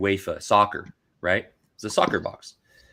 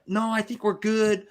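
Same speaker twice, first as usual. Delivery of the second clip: higher, breezy polite no; polite decline; 12 semitones higher than usual. Retold or imitated. Retelling how he turned down the food, in a higher breezy polite tone. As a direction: brush off the offer politely. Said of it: "I was like".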